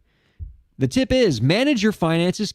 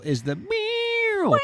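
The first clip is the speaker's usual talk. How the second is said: high drawn-out meow, much higher; sung meow; 4 dB quieter, much slower than usual. Retold or imitated. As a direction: sing a long cat meow. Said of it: "favorite part of the A I overlords jingle".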